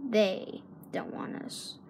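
slow, soft, defeated whisper; bitter whisper. Acted. Captain admitting to his crewman nobody wants them, whispering. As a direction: let it drop to a whisper, beaten.

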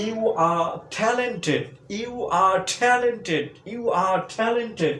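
slow, high "You are talented" with are reduced; drilling the class on "talented"; you're contracted. Shown as a demonstration. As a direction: stretch out "You are talented" slowly, are a short "আ". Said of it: "ওটা উচ্চারণ করতে হবে আ".

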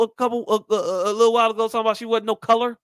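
ordinary delivery

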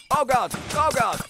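loud, high oh God; startled cry. Real, not acted. Gamer caught by a trap, startled loud cry. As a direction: yelp in shock.

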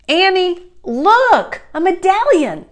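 high, loud, insistent call. A narrator's reading. Jack calling to an inattentive Annie, insistent loud call. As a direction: call for attention, word by word.

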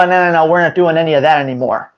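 fast, clipped-off no's; rushed refusal. Retold or imitated. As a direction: shut it all down in a hurry. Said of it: "you go".

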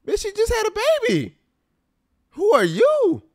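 high, fast indignant squeal; outraged squeal. Real, not acted. Outraged at boss calling.

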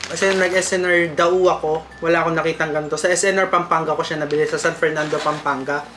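speaking between bites; talking while chewing popcorn. Real, not acted. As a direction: mouth partly full of food. Seen 0: Hand feeds popcorn to mouth repeatedly; chewing while talking.

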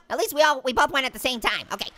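usual speaking voice

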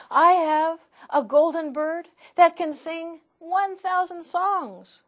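lightly voiced proud rich man; proud offer. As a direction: show off the treasure.

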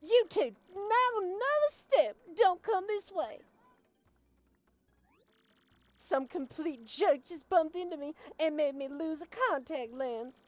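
angry order. Acted. A woman hunting her lost contact lens, shouting orders. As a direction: bark orders so nobody moves.

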